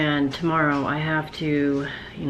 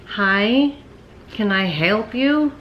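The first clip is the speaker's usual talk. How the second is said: mock polite; 6 semitones higher, 4 dB louder, much slower than usual. Real, not acted. Dog interrupts her.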